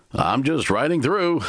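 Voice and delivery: Timber, easy. A narrator's reading. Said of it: "Timber stated".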